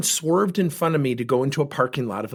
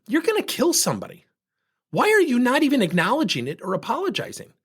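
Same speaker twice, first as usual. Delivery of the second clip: higher, heated accusation; angry scolding; 9 semitones higher than usual. Retold or imitated. Scolding the driver for nearly causing a crash, heated. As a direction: call him out, voice climbing. Road rage confrontation retold.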